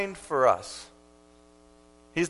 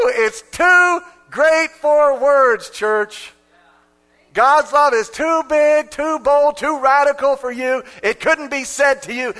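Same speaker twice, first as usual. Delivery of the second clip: high, loud, slow sermon climax; preaching at full force; 9 semitones higher, 8 dB louder, slower than usual. Acted. Preacher to his church, preaching at full force. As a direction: drive it home to the church.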